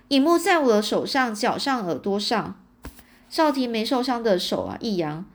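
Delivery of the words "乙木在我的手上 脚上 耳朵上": usual speaking voice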